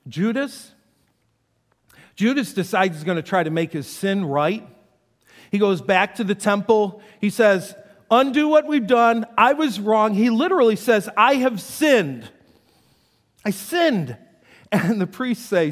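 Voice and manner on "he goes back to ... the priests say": loud, high, pleading preaching; fervent preaching. Acted. Pastor voicing Judas confessing to the priests, loud and pleading.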